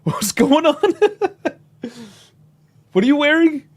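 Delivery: high, loud mock disbelief; baffled Irish Prime Minister. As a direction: play the stunned prime minister staring at the socks. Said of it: "The Irish Prime Minister was just like".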